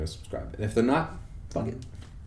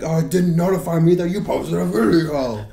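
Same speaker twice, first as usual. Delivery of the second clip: mocking complainer; 8 semitones higher, 7 dB louder than usual. Mimicking fans who complain.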